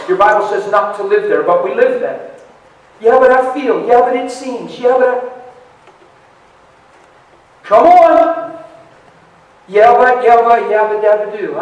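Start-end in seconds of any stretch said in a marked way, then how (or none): 3.0-5.2 high, loud protesting whine; mock excuse-making. Preacher mimics believers who say "yeah, but", mock protesting. 9.7-11.6 loud, repetitive mock protest; mock excuse-making. Preacher mimics believers piling up "yeah, but", mock protesting.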